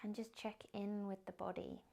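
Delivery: soft, unhurried meditation guidance; guided meditation. Acted. Yoga teacher guiding the class into a body scan, soft meditation voice. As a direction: ease them into stillness gently.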